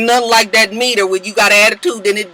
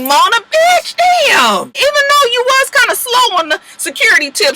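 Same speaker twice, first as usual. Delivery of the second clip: playful call-out; 14 semitones higher than usual. Real, not acted. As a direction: yell it at the producer half-joking. Calling out the producer.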